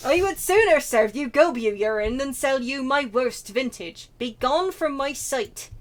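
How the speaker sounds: scornful, forceful dismissal in a put-on accent; indignant vintner in a put-on accent; haughty wine snob. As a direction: send them packing with theatrical contempt. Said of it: "I don't even know what accent this is".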